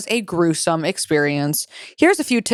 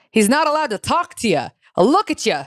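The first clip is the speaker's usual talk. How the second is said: badly faked New York Italian accent, pitched up; 4 semitones higher than usual. Shown as a demonstration. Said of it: "He has a New York Italian accent".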